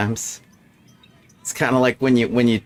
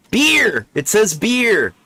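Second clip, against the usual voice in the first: Beer! shouted high, triumphant; excited discovery; 11 semitones higher, 4 dB louder, slower than usual. Real, not acted. Player deciphering a scrawled shopping list, thrilled to find beer. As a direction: crow it like a big find.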